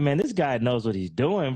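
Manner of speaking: ordinary talk, nothing marked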